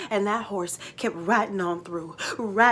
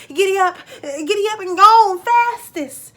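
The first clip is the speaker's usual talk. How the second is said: loud, high yell urging the horse; shouting giddy up; 11 semitones higher, 13 dB louder than usual. Acted. Jake spurs the fastest mare on, loud high yell. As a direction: holler at the mare to run faster.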